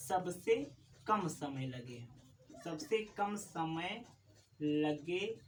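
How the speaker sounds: a slow, spaced repeat, a pause before each piece; slow dictation. Shown as a demonstration. Teacher repeating definition for students, slow and deliberate. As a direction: let every word land so students can write. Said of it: "teacher repeats definition slowly".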